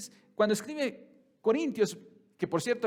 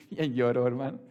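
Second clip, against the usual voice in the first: laughing; 6 semitones lower, 5 dB louder than usual. Real, not acted. Joke about [name].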